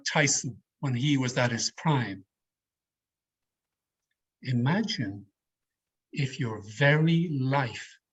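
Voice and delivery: opening line of a stage speech, with weight and pauses; dramatic speech opening. Shown as a demonstration. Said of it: "I started out the speech like this".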